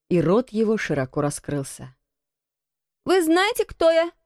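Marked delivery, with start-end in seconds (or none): 3.0-4.1 put-on high-pitched, commanding boy; imperious high boy's voice. A narrator's reading. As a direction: demand it like a young lord, voice raised. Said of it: "повелительно спросил Колин".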